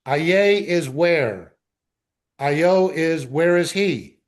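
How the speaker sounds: normal talking voice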